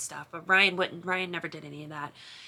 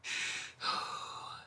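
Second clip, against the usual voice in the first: shaky breathy sob-sigh; tearful sigh; 8 dB quieter than usual. Real, not acted. Sister overwhelmed by her brother's words, tearful sigh. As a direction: let out a crying breath. Crying after brother's question.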